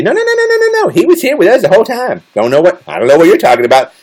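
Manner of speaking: high-pitched mock protest; protesting alibi witness. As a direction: play a friend insisting on the alibi. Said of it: "y'all can all be my alibis and say".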